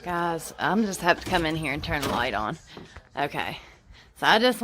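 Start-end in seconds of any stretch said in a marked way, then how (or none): none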